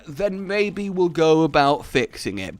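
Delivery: ordinary delivery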